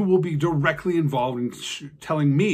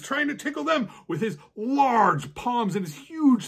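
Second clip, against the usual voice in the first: ordinary delivery, nothing marked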